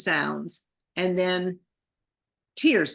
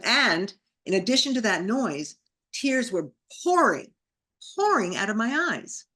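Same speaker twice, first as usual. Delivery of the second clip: usual speaking voice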